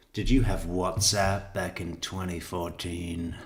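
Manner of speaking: ordinary talk, nothing marked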